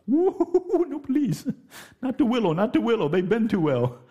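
put-on high, frightened child pleading; hammed-up child begging for mercy; mock begging child. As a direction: beg like a kid facing the switch. Child begging not to be whipped.

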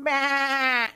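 a little mock lamb bleat. Preacher mimics a lamb's bleat for the congregation, lightly.

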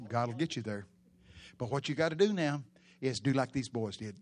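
ordinary talk, nothing marked

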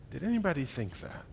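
hushed whisper to the room; a whispered aside to the congregation; whispered question. Real, not acted. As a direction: lean in and whisper it.

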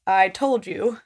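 booming voice from the sky; God voice. Pretend. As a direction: speak as God, teasing. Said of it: "That's my God voice".

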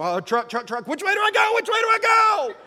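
frantic high, loud squeal; hammed-up high-pitched panicked shouting; freaking-out squirrel. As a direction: panic like a squirrel facing a truck. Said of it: "it's like they're freaking out".

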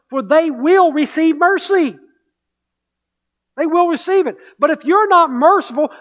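high, loud fervent sermon voice; impassioned preaching. Acted. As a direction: drive it home from the pulpit. Preaching at full force.

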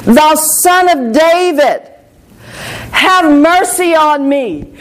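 stretched, imitated plea, not truly shouted; imitated crying out. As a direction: call out slowly like a blind beggar pleading over a crowd. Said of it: "Bartimaeus began to cry out".

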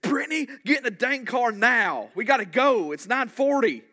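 put-on high, loud exasperated yell at a teen; mock shout. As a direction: bellow it out like a fed-up youth pastor. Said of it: "I'm gonna whisper it, 'cause if I scream".

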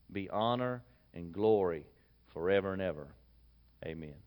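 measured, reverent reading of scripture; steady pulpit reading voice. Acted. Reads scripture aloud before sermon.

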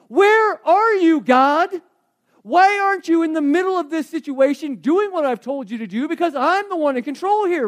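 loud, high, demanding shout at God; frantic loud cry of someone terrified; panicked cry to God. Acted. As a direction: shout the accusation, stretching out where. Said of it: "That's what we do".

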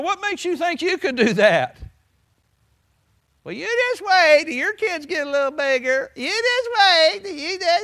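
high, loud whiny mock of doubters; mocking naysayers. Preacher mocking doubters in a high, loud whine.

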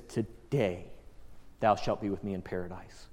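low, hushed voice of Jesus; gentle assurance. Retold or imitated. Jesus to the dying thief beside him, gentle assurance. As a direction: drop deep and soft to promise it.

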